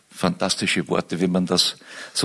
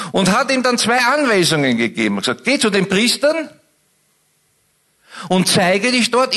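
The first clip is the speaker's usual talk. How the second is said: raised, intense sermon voice; passionate preaching; 8 semitones higher than usual. Acted. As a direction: preach it with full force. Preaching at full force.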